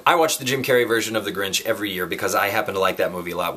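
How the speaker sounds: normal talking voice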